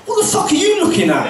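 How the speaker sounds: high, fast mock aggressive barmaid; hammed-up rough local snapping; rough pub woman. Rough pub landlady challenges the comedian, mock aggressive and high. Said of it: "It was".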